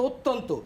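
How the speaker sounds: অত্যন্ত with a long o at the start, "oo-tton-to"; o-sound pronunciation. Shown as a demonstration. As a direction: stretch the first vowel of অত্যন্ত into o. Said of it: "উচ্চারণ হচ্ছে ও এর মতো".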